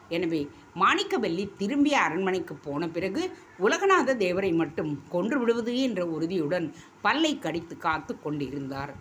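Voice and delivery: ordinary delivery, nothing marked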